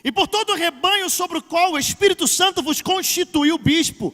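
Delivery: loud, high, declaimed scripture; full-force preaching. Acted. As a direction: thunder the warning out to the congregation. Pregação a plena força. (pregação a plena força, voz alta)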